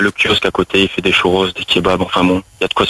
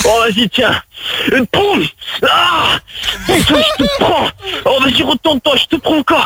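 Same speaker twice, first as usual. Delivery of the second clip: hammed-up climax. Improvised sex scene, mock climax.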